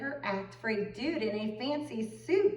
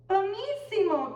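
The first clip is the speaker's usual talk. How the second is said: high, louder cry; excited cry; 10 semitones higher, 5 dB louder than usual. A narrator's reading. Angelina cries out in delight, high and loud. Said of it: "cried Angelina".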